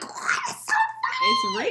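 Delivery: high, strained yell; hammed-up shriek at a laughing boyfriend; retold exasperated screech. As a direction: demand an answer in mock fury.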